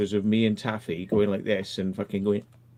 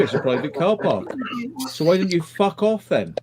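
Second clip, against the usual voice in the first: louder, higher "fuck off then"; mock angry telling-off; 6 semitones higher, 4 dB louder than usual. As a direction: rise into a cheeky dismissal. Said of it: "And I said".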